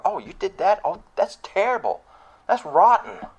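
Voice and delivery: hammed-up judgmental gasp; mock scandalized. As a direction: play a self-righteous scold clutching pearls. Leaders condemning people, voiced.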